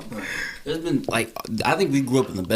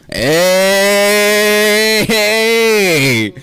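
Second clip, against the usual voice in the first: long drawn-out hey, higher and louder; mock call-out; 9 semitones higher, 5 dB louder, much slower than usual. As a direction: call out hey playfully across the room. Mock greeting a caller.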